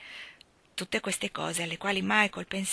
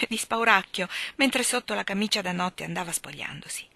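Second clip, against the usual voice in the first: plain reading voice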